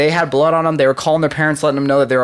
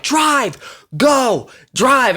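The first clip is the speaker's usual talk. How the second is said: panicked yelling; 9 semitones higher, much slower than usual. Retold or imitated. Victims yelling at cab driver.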